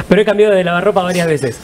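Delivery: hablar aguantando la risa, rápido; laughing through a quick joking admission; entre risas. Real, not acted. Broma con risas compartidas. (entre risas, rápido)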